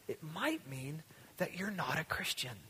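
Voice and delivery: hushed, grave whisper; a soft whispered warning to the congregation. Real, not acted. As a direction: let the hard truth land in a whisper. Sobering close of sermon.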